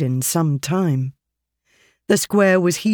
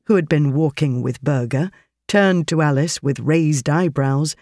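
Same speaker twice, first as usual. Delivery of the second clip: ordinary delivery, nothing marked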